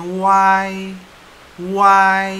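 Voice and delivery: "Y" as a long "wai", high, loud and level; diphthong wai. Shown as a demonstration. Teacher reading U A I, loud long "Y". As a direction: glide from W through A to I on "Y". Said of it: "Jadi bacanya Y".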